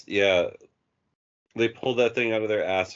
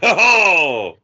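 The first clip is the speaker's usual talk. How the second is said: loud, high mock wail; long, exaggerated "oh" of shock; imitated reaction cry; 8 semitones higher, 9 dB louder than usual. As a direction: join in mimicking a friend's overblown reaction. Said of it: "You know how Dave reacts".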